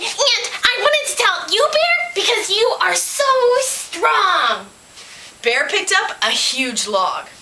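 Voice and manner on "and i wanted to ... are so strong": high, gushing pig voice; admiring pig. A narrator's reading. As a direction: flatter the strong bear, stretching the praise. Storyteller voices Pig flattering Bear.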